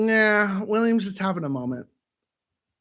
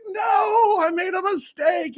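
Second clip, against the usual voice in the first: high, loud cry of regret; mock wail; 13 semitones higher, 4 dB louder, slower than usual. Voicing Mickey as everything overflows, mock wail. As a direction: wail it in hammed-up despair. Voices regretful cartoon character.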